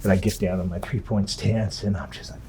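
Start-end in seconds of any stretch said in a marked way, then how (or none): none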